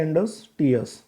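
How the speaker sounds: Industrious parted into "indus" and "trius"; two separate sounds. Shown as a demonstration. Teacher pointing out two sounds, industrious said as "indus, trius". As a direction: say industrious as two parts with a short gap. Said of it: "दो sound आ गए".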